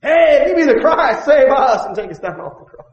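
loud, high shouted taunt; jeering crook. Acted. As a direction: throw it up at him as a mocking thief. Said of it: "they railed upon him".

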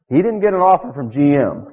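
ordinary talk, nothing marked

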